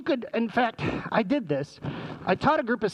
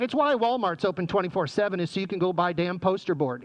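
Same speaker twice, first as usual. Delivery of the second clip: ordinary talk, nothing marked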